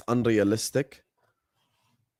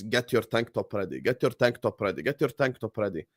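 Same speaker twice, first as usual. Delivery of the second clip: mock chat message; 4 semitones lower, 7 dB quieter than usual. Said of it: "I got seventeen messages".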